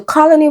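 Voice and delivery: "colony" with COL hit high and loud; first-syllable stress. Shown as a demonstration. Teacher breaking down the root word, first-syllable stress. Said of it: "It's the same with colony".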